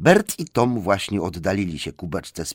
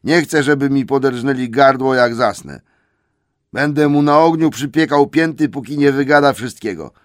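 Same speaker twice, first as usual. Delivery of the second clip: higher, loud troll refusal; stubborn troll; 5 semitones higher, 7 dB louder than usual. Acted. Troll Bert refuses to let the hobbit go, loud. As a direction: insist loudly.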